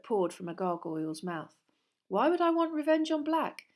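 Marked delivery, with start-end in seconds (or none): 2.1-3.7 put-on high, loud, puzzled boy; baffled question. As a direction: ask it high and bewildered. Harry baffled by Malfoy's taunt.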